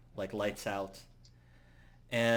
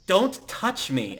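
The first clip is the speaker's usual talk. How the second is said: high, slow, loud warning; acted indignant outburst; 8 semitones higher, 5 dB louder, slower than usual. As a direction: warn them off firmly, word by word. Acted quarrel, recoiling from grab.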